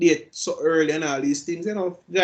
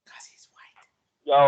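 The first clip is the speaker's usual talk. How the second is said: whisper; 7 dB louder, much slower than usual. Real, not acted. Whispered aside on news story.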